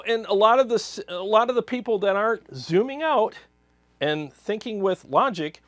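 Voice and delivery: normal talking voice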